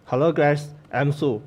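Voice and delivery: ordinary talk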